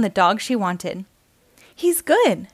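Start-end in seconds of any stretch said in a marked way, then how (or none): 1.7-2.5 put-on eager girl. A narrator's reading. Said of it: "she said".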